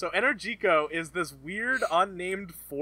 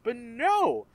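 high, slow, emphatic exasperation; exasperated rant; 7 semitones higher than usual. Real, not acted. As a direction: draw out each damning judgement in higher pitch. Exasperated rant about the movie.

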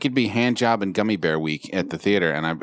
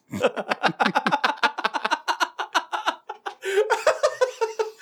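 long high-pitched laugh. Real, not acted.

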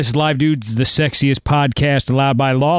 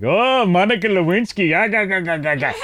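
higher-pitched put-on foreign teasing; hammed-up gabbling mockery; mock jeering Italians; 4 semitones higher than usual. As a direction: imitate diners cackling at an American. Imitating Italians teasing about Lewinsky.

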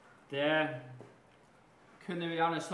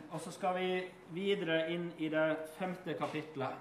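ordinary talk, nothing marked

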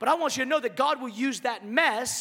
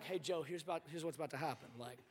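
quiet, confiding God; 9 semitones lower, 16 dB quieter, faster than usual. Retold or imitated. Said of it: "I would have been like".

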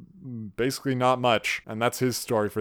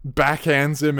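usual speaking voice

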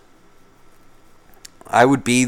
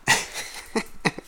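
breathy laugh; 7 dB quieter than usual. Real, not acted. Laughs at own joke.

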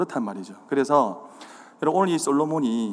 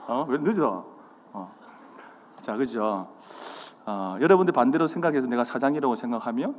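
normal talking voice

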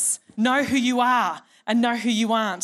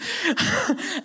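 laugh; 9 semitones higher than usual. Real, not acted. Laughs at own impression.

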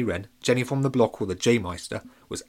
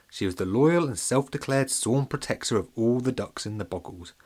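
ordinary delivery, nothing marked

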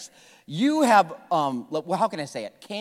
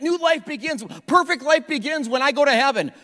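higher-pitched mock naive voice; mimicked believers; 8 semitones higher than usual. Preacher voicing what people think, lightly mocking and higher.

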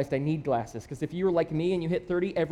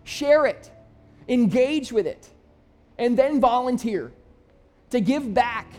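higher and louder, building the sermon's big call; preaching at full force; 6 semitones higher, 5 dB louder than usual. Real, not acted. Pastor urging his church to volunteer, rising preaching voice. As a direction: lift it up and drive it home to the room.

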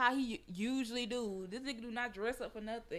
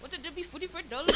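put-on louder, higher caricature of Apu's accent; mock Apu accent; 7 semitones higher, 5 dB louder, faster than usual. Riffing on Apu from the Quickie Mart, mock accent. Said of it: "She fucking Apu from the Quickie Mart".

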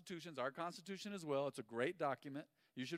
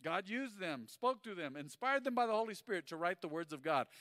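normal talking voice

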